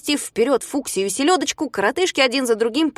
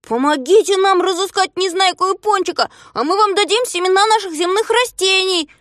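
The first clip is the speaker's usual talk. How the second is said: high, raised calling voice; Знайка предлагает издали; 10 semitones higher, 5 dB louder than usual. A narrator's reading. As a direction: plead an offer across a distance. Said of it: "предложил Знайка". (высоким голосом, громко предлагая)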